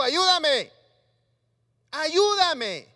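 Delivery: loud, desperate cry of ayúdame; shouted plea. Acted. Preacher acting Pedro calling to Jesus from the water, a loud desperate shout. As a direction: yell it like a drowning man. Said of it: "Perdón si grito".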